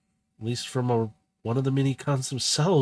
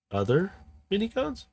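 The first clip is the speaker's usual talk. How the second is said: surprised; 9 semitones higher than usual. Acted. Speedstar catches the slip.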